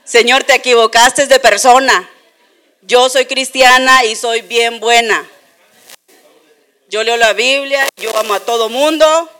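mock self-righteous pleading, lightly played; indignant protest.

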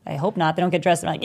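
normal talking voice

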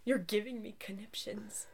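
soft, breathy whisper; hushed whisper, savoring it; whispered quote. Retold or imitated. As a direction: whisper the line fondly. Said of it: "I love it".

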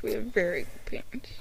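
soft unvoiced whisper. Real, not acted. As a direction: breathe it, no voice at all. Repeat whispered.

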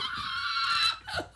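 very high screeching laugh; pterodactyl laugh. Real, not acted. Laughing at a friend's joke, pterodactyl screech. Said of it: "You sound like a pterodactyl".

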